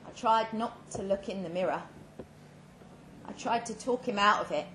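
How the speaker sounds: ordinary delivery, nothing marked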